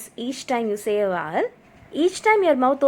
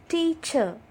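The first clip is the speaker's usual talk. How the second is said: TEA, CHER as two separate beats; syllable by syllable; 5 semitones higher than usual. Shown as a demonstration. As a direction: split Teacher in two for clapping. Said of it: "Clap with me as I say these words".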